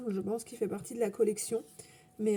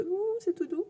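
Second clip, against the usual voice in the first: mimed cooing delight; 9 semitones higher than usual. Acted. Mimes cooing over the fluffy pen.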